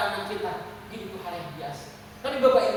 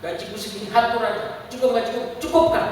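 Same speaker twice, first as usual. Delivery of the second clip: imitated dismissive husband; 3 semitones higher, 5 dB louder than usual. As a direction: lay down the law, rising with each phrase. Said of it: "Gitu ya suaminya". (menirukan suami, makin keras dan tinggi)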